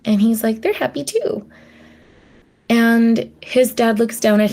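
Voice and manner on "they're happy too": lightly voiced happy chick, higher; a hint of a cheerful baby penguin answering his dad; young penguin telling his dad he is happy, lightly voiced and higher; little penguin. Mumble answers his dad again.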